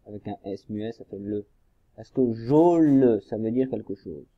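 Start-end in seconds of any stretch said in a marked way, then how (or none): 2.3-3.2 "jo le" slow and loud, "jo" drawn out; syllables joined slowly. Shown as a demonstration. Teacher tests whether the joined syllables make a word, slow blending. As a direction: stretch "jo" and attach a full "le". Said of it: "si je prends la première syllabe".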